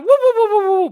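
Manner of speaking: high, loud Stooges whoop; Three Stooges woo. Retold or imitated. Ruling out spinning like the Three Stooges, loud whoops. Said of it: "Three Stooges".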